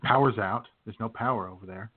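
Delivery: ordinary delivery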